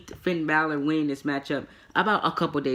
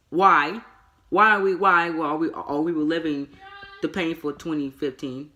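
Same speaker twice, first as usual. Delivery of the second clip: exasperated; 3 semitones higher, 5 dB louder than usual. Real, not acted. Wrestling reviewer complaining about a tired storyline, exasperated. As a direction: demand an answer, loud and fed up.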